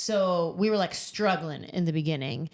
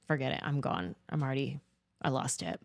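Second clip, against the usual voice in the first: quiet resignation; 6 semitones lower, 6 dB quieter than usual. Retold or imitated. Retelling how she loses it mid-argument.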